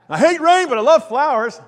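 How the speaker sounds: hammed-up griper caught contradicting himself; mock contradiction. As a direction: exaggerate the silly grumble. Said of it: "What a contradiction".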